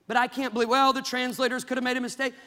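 scornful imitation of a doubter; mocking skeptic.

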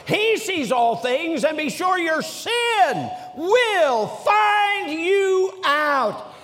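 slow, high, forceful pulpit warning; thundering preaching. Real, not acted. Preacher warning that sin will find you, thundering. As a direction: draw each word out and build to a shout.

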